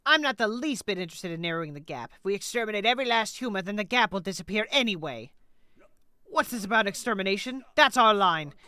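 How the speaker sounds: declamatory hostility, punched at key words; dramatic, building condemnation; menacing exterminator. Acted. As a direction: pronounce doom on the enemy. Voices hostile game figures.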